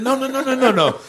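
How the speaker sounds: high-pitched string of insistent objections; frantic protest. Retold or imitated. Musicians to the doorman slamming the door, high frantic protest. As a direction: plead your case in a soaring, hammed-up voice.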